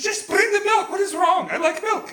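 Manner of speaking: high-pitched mock indignant mother-in-law; indignant protest. Mother-in-law defending her gallon of milk, indignant.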